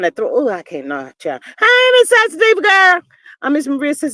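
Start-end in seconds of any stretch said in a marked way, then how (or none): 1.6-3.0 high, loud sing-song greeting; mimicking the guest. Imitating the guest greeting, high mock gush. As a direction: do her gushing hello. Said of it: "she said it like that".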